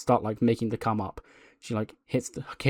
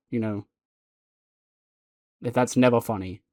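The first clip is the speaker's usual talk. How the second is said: ordinary talk, nothing marked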